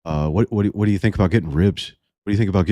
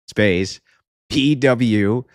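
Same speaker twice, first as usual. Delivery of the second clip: usual speaking voice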